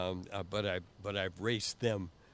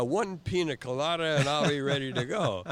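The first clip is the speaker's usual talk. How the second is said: louder, swaggering punchline; raised, triumphant retelling; cocky boast; 5 semitones higher, 7 dB louder than usual. Retold or imitated. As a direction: deliver the pina colada brag. His boastful reply.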